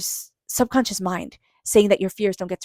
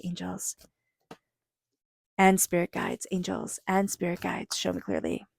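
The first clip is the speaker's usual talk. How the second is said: a hushed ritual prayer before a tarot reading; soft repeated invocation; 6 dB quieter than usual. Real, not acted. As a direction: call quietly on the angels again and again. Chanted invocation over tarot cards.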